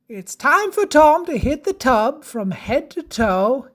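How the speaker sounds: ordinary delivery, nothing marked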